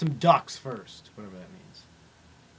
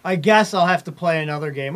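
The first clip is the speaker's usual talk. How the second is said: ordinary talk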